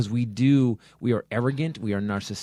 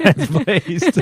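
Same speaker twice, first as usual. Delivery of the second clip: normal talking voice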